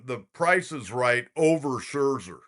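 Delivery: usual speaking voice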